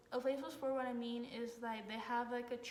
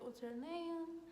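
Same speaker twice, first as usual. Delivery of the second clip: higher-pitched, friendly question; trying to be nice; 6 semitones higher, much slower than usual. Retold or imitated. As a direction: ask it nicely, as if coaxing a stranger. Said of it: "We're trying to be nice".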